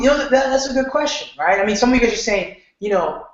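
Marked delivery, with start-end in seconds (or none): 0.0-1.6 mock thoughtful reply. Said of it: "And I was like".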